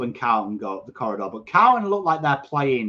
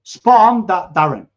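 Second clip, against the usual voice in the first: excited praise; 5 semitones higher, 9 dB louder than usual. Real, not acted. Cheering a player.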